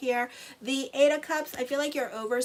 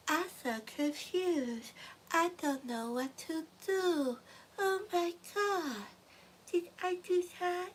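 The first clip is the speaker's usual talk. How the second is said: slow, much higher, whiny dithering; mock confused client; 7 semitones higher, 5 dB quieter, slower than usual. Reader mocking viewers who flip-flop on decisions, slow and whiny. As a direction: play a flustered ditherer rising into panic. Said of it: "you won't be so".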